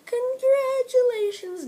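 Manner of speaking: high sing-song mock praise; high sarcastic congratulations. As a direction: tease her with fake cheer. Mocking congratulation.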